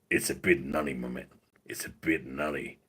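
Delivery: low, softened catchphrase impression; Randy. Showing how Randy would say it, low impression. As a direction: sink low and quiet on "my man". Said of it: "In Randy's voice".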